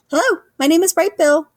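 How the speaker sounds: high, loud little gosling; proud little gosling introducing himself. A narrator's reading. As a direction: chirp it out like an eager baby goose. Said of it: "he said to who".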